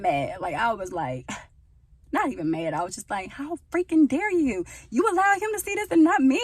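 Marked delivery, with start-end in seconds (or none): 3.3-6.4 high, loud mock indignation; petty outrage. To God, petty high loud outrage. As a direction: squeal it like a betrayed friend. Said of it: "being real petty".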